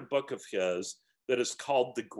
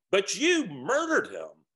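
higher and louder, outraged; accusing ghost; 7 semitones higher, 5 dB louder than usual. A narrator's reading. Ghost accuses the bright spirit, outraged. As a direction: throw the accusation at him.

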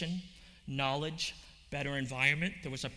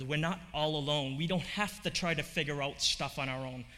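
ordinary delivery

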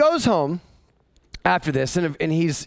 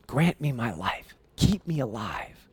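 soft pleading; 4 semitones lower, 6 dB quieter, slower than usual. Acted. Esther pleading with the king.

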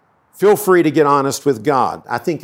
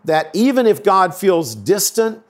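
normal talking voice

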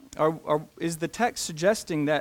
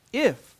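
"If" hit high and loud; stress on If; 7 semitones higher, 4 dB louder than usual. Shown as a demonstration. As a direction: land hard on "If". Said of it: "not, not "you will abide," but".

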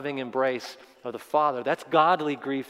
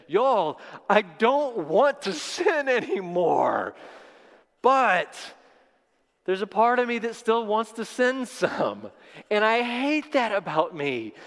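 frustrated Paul; 7 semitones higher than usual. Acted. Paul confessing inner war.

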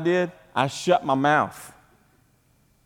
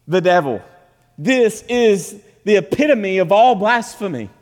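raised, louder preaching voice; forceful preaching; 7 semitones higher, 5 dB louder than usual. Real, not acted. Pastor to congregation on blasphemy, forceful preaching. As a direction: preach it with hard emphasis.

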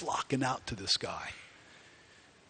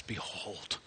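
soft, low whispered behold; hushed reverent behold; 6 semitones lower, 12 dB quieter than usual. Retold or imitated. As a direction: breathe the word out low.

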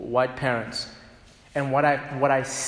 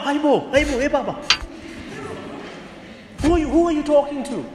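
put-on high, fast rebuke of the boy; a father calling "Baba" sharply; scolding African parent; 9 semitones higher, faster than usual. Parent scolding son "hey boy", high and sharp. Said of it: "When you say".

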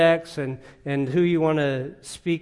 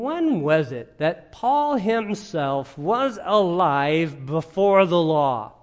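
very slow, weighing "Paul himself"; deliberate challenge, each phrase stretched and stressed; pointed rhetorical question; slower than usual. Real, not acted. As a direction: draw out "alive" and "before the law" word by word. Preacher poses a pointed rhetorical question.

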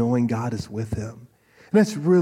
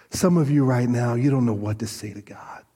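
ordinary talk

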